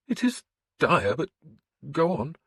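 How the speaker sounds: the priest questioning a pagan lord; wary priest. A narrator's reading. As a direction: probe him gently as the suspicious priest.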